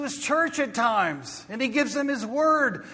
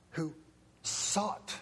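soft, low, fading appeal; hushed preaching; 9 semitones lower, 14 dB quieter than usual. Real, not acted. Preacher closing the sermon in a hushed appeal. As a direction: draw them in quietly.

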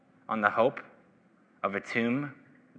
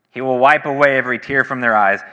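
louder, higher proclamation, hitting wipe; loud proclaimed promise; proclaiming; 6 semitones higher, 12 dB louder than usual. Acted. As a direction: ring it out over the church. Proclaiming the promise, first reading.

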